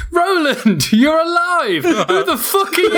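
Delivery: laughing, overblown cry of surprise; mock shout. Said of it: "he said".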